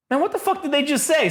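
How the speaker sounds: high, incredulous "what the fuck"; exasperated outburst. Retold or imitated. Listening to the track, baffled by the dialogue, high exasperated outburst. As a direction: pitch jumps way up in disbelief.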